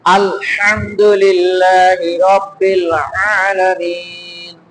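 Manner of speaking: slow recitation, pressing the throat on "হা" in "আলহামদুলিল্লাহি"; pressed ha and ain. Shown as a demonstration. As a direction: draw out "আলামিন" with a pressed ain. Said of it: "হা আর আইন চাপ দিছি".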